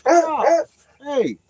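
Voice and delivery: sharp high scold. Real, not acted. Owner yelling at the barking dog to stop, high sharp scold. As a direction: shut the barking down hard.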